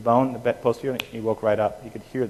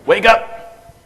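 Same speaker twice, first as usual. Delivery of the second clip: loud, sharp call of "wake up" pitched higher; sergeant wake-up call; 10 semitones higher, 10 dB louder than usual. Shown as a demonstration. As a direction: bark "wake up" at the patient like a drill sergeant. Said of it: "I drop it down to sergeant level".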